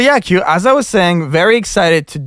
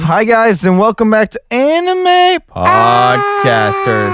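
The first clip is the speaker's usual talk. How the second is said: podcast host intro; 9 semitones higher, 4 dB louder, much slower than usual. Acted. Podcast intro in a sing-song voice.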